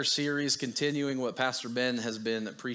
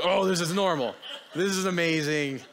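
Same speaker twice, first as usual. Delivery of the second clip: laughing; 6 semitones higher, 6 dB louder than usual. Retold or imitated. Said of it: "And I was like".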